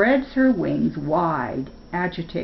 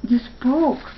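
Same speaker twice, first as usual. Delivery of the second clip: high, louder amazed cry; astonished; 8 semitones higher, 4 dB louder than usual. A narrator's reading. Spark amazed that his friend Chipper spoke, high and loud. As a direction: gasp it out in wonder. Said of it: "Spark is astonished".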